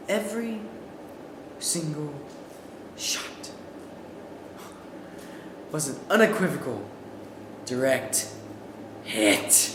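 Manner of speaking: quiet, word by word with long pauses; dramatic pauses. Acted. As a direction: lay out each word for effect. Dramatic slow emphasis.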